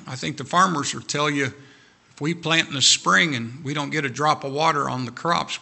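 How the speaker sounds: normal talking voice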